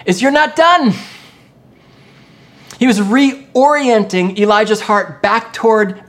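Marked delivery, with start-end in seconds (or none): 0.2-0.9 high, loud proclamation; forceful preacher's cry; emphatic exhortation. Acted. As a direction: call it out to rally someone. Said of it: "What he was basically telling Elijah".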